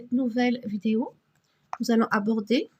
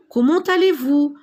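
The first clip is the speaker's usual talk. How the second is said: "Comment allez-vous?" lifted higher and louder; raised question voice; 5 semitones higher, 6 dB louder than usual. Shown as a demonstration. Teacher showing pupils how to greet someone with a question. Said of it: "vous devez toujours élever un peu la voix".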